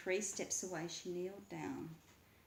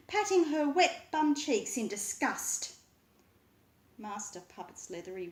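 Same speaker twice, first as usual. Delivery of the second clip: plain reading voice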